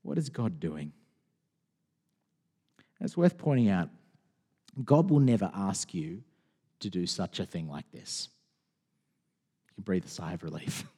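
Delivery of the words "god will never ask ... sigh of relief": soft reassurance. Real, not acted. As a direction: lower and much softer, calming. Reassuring the congregation softly.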